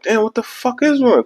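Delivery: high panicked thought; mock panic. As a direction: freak out inwardly, voice up high. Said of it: "so now I'm bugging".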